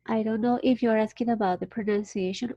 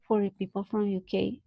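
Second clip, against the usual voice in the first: ordinary talk, nothing marked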